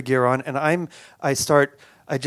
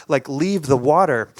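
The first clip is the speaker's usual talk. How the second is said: plain reading voice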